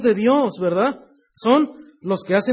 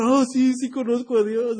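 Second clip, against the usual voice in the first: mock tearful protest; imitated crying; 3 semitones higher than usual. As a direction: lightly imitate a child protesting through tears. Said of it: "Y estaba llorando".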